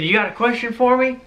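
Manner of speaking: high-pitched impression of a dad; Barry. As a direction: imitate a father's thick accent, pitched well up. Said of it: "thick accent".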